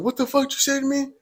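high-pitched mimicked outrage, no real volume; retold yell back. Stranger challenging the teller, high imitated yell. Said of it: "he yells back".

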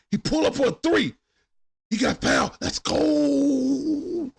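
high, loud cheering cry; excited yell. Real, not acted. Streamer cheering his player fouled on a three, yelling. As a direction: let it rip in celebration.

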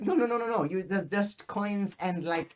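put-on silly German accent, starting high; strong German accent. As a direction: insist in a stupid German accent. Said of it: "I had a really strong, stupid accent".

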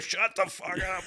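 high barked mock order; drill instructor. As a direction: snap it like a drill sergeant. Drill instructor yelling back.

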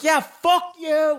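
high, drawn-out mock shout; retold yelling back. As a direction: re-enact yelling it back, stretched out. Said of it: "I'm just fucking yelling at him".

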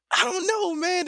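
high-pitched doubtful reply; mock bewilderment. Viewer retelling doubts about the nude scene, mock bewilderment. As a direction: go high with exaggerated uncertainty.

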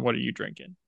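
normal talking voice

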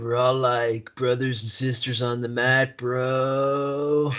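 lightly voiced drawn-out bro talk; laid-back bro. Guest teases podcast partner about mat buddies, light bro voice. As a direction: stretch it out like a hint of a dude-bro.

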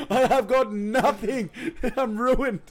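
high, slow, laughing self-pity; laughing mock despair. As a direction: wail it while cracking up.

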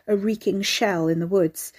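ordinary delivery, nothing marked

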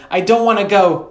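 very high, loud refusal; screamed. A narrator's reading. Sister refusing her brother's coaxing, screamed. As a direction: shout it like a child digging in. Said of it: "she screamed".

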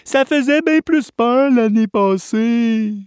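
whiny campers. Acted. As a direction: complain like sleepy kids.

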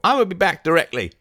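high, loud, eager promise; excited cry. A narrator's reading. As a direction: call it out eagerly in rustic voice. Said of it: "cried Barnstable".

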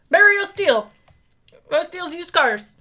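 high, loud car salesman; used car salesman voice. Acted. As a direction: slick dealer pitch. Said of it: "spoke like that".

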